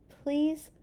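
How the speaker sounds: high, loud begging. Acted. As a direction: plead with all your heart. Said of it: "he didn't need to beg".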